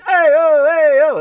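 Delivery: high, loud hammed-up street hawker calling; Italian waiter call. Little Italy tout hailing passing tourists, loud hammed-up call. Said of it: "A lot of this".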